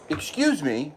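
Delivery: high, loud indignant outburst; indignant surprise. Real, not acted. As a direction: catch the pet red-handed, outraged. Catching cat in the act.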